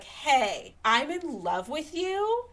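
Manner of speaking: a smug suitor proposing with insults, slow and lofty; pompous Darcy. Retold or imitated. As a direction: talk down to her while proposing.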